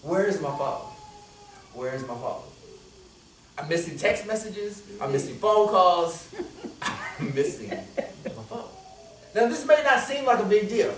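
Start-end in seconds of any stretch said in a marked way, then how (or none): 0.0-2.4 lower, softer, trailing off; fading plea. Retold or imitated. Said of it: "I wake up, I say". 6.8-8.7 slow, low, soft mock misery; laughing lament. Real, not acted.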